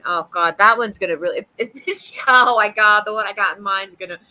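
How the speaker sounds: usual speaking voice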